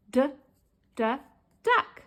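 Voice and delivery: two separate short "d" sounds, then "duck"; sounded-out D. Shown as a demonstration. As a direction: tap out the D before saying "duck". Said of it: "D sound".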